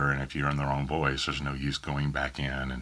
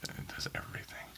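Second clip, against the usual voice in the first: whispered reply; 15 dB quieter than usual. Real, not acted.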